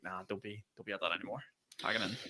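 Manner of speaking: telling himself to quit the vlog, muttered softly; quiet self-talk. Retold or imitated. Quoting himself giving up vlogging.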